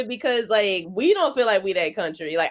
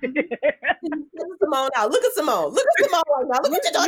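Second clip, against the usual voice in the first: laughing; 6 semitones higher than usual. Real, not acted. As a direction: cackle through the words. Laughing at friend.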